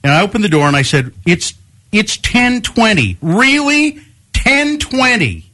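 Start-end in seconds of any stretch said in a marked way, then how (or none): 1.2-5.5 high-pitched, drawn-out disbelief; retold incredulous scolding. Homeowner to late trick-or-treater at the door, acted incredulity. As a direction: stretch each phrase in exaggerated indignation.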